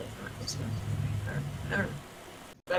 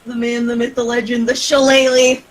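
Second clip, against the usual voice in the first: building, louder each phrase; hype announcer; 3 semitones higher, 10 dB louder than usual. Acted. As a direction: announce like a ring intro. Hyping a character.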